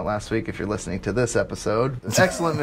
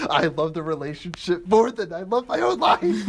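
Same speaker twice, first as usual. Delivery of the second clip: higher, gushing mock quote; mocking imitation; 7 semitones higher than usual. Throwing friend's earlier teasing back, high mocking imitation. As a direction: exaggerate your friend's devotion, pitched up. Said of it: "and you're like".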